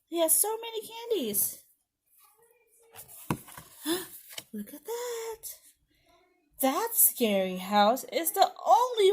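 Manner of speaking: animated, sing-song read-aloud with high swoops; children's story narration. Acted. Teacher reads a Halloween book to preschoolers, animated storytelling. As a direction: read it to little ones, full of wonder.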